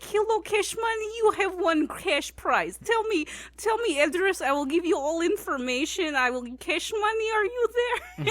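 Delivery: high, loud mock Russian accent. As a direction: prank caller pitching a prize in put-on Russian English. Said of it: "I started doing this accent".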